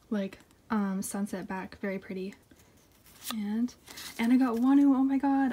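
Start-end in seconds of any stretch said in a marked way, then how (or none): 4.2-5.5 louder, higher burst of delight; real excited exclamation over a surprise; thrilled. Real, not acted. Collector pulling another bias wrecker, thrilled. Excited reveal of pulled card.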